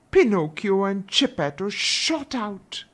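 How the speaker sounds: plain reading voice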